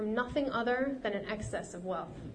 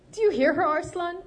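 high and loud, close to tears; a frantic plea to his brother; tearful appeal; 8 semitones higher, 8 dB louder than usual. Acted. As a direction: cry out to his brother in distress. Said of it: "tears in his eyes".

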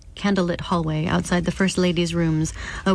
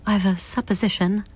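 usual speaking voice

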